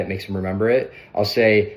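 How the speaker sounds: usual speaking voice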